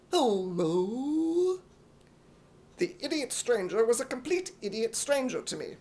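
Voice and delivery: ordinary talk, nothing marked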